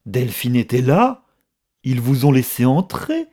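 loud, incredulous accusation climbing high; questions flung out loudly, pitch leaping up; violent outcry. A narrator's reading. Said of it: "s'écria-t-elle avec violence".

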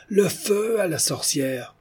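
high, drawn-out rallying cry. A narrator's reading. Morozza sets the mob on the witch, crying out. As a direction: call it out slow and very high. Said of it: "cria Morozza".

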